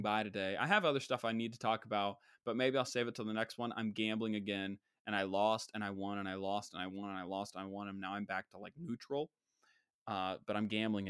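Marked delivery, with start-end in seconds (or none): none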